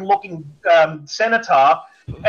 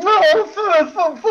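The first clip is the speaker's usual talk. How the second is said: high stammering mock voice; mock flustered dad; 13 semitones higher, slower than usual. Mocking the San Francisco dad stammering, lightly high sputter. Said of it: "a typical San Francisco fucking".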